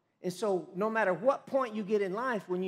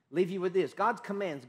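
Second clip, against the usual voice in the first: ordinary delivery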